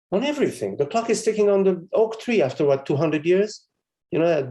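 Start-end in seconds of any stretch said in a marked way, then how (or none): 0.1-2.4 a quick laugh through the words; amused, laughing aside. Real, not acted. Laughs while talking about time.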